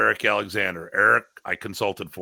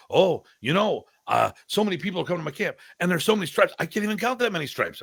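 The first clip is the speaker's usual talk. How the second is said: higher, quicker mimicry of borrowed phrasing; mock copycat student; 7 semitones higher than usual. Teacher mocking students who talk like the master, high-pitched imitation. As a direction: parody someone aping the master's sentence structure. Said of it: "listen to their sentence structure".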